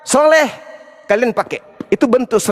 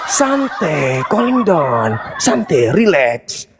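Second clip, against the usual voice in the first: mock soothing; 7 semitones lower, much slower than usual. Mocking opponent to calm down.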